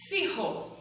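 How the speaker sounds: "Fijo" with a throaty h-like J, fee-ho; Spanish J sound. Shown as a demonstration. Teacher models "Fijo" for Portuguese learners, Spanish J. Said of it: "Fijo se escreve com la J".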